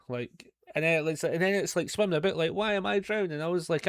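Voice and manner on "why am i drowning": squeaky mock panic, pitched higher; mock fly voice. Voicing the fly drowning in his stew, in a high mock panic.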